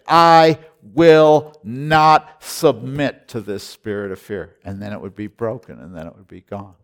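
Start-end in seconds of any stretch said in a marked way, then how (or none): 0.1-4.6 loud, very slow proclamation; rebuking fear. Retold or imitated. Preacher on a plane rebuking the spirit of fear, loud. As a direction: declare it hard against the fear. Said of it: "I'd say".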